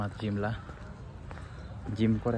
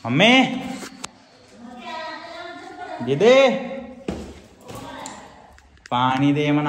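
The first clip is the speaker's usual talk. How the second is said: loud high shout for mom; 10 semitones higher, 8 dB louder than usual. Real, not acted. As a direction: yell for water from another room. Shouting for water.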